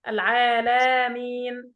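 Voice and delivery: ordinary talk, nothing marked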